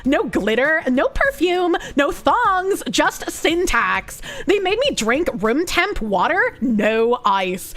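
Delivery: put-on melodramatic Valley girl with swooping pitch; hammed-up, traumatized Valley girl. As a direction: play a Valley girl recounting a horror story. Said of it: "replicate Kirsch's Valley Girl voice".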